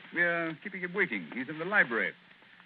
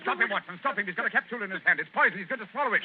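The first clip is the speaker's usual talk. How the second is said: higher, louder alarm call; urgent shout; 6 semitones higher, 5 dB louder, faster than usual. Acted. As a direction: yell the warning across the room. Holmes sees the poison capsule.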